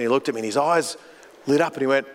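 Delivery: ordinary talk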